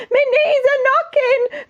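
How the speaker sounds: very high, drawn-out wail; scared cook. Acted. Cook afraid of fishing for the king, high panicky wail. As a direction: squeal it like a terrified storybook wimp.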